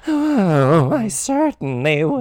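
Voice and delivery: high, slow coy flirt. Retold or imitated. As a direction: purr it with a coy glance back. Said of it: "as she looks back up and down".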